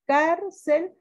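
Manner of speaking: "Cárcel" split, CAR pitched high; stress on first syllable. Shown as a demonstration. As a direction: hit a high CAR in "Cárcel", then cel apart. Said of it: "sí lleva sílaba tónica".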